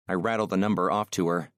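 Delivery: ordinary delivery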